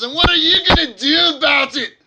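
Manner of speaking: high, loud scornful dwarf shout; angry dwarf challenge. Acted. Dwarf yelling his challenge at the strangers. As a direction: demand an answer, furious.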